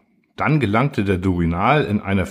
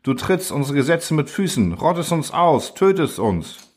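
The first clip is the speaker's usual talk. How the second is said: outraged cry; 6 semitones higher than usual. A narrator's reading. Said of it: "schrien Spiriten und Erlauchte".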